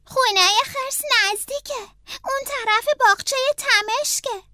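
calm the crying snail; reassuring Franklin. Acted.

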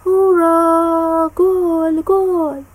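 high, loud, drawn-out goal cheer. Acted. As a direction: yell it like a football crowd on the telly. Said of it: "شروع کرد به هوار زدن".

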